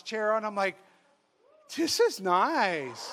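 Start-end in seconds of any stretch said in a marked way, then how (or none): 1.7-3.1 slow, drawn-out savouring. Retold or imitated. Priest in a massage chair, savouring it. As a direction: stretch the words out in comfort.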